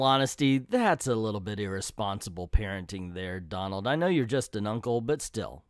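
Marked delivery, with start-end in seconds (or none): none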